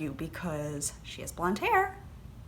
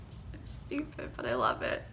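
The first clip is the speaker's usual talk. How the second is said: sheepish whisper; 7 semitones lower, 6 dB quieter than usual. Real, not acted. Sheepish aside.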